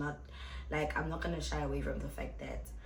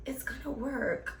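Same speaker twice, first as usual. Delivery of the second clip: whispered aside; 5 semitones higher than usual. Real, not acted. Whispered aside about dating.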